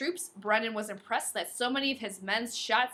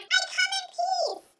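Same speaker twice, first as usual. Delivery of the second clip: movie alien; slower than usual. Retold or imitated. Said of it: "they're like".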